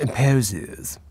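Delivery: lightly mocking posh English drawl on "Imposes"; posh mimicry. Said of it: "just because he speaks like that".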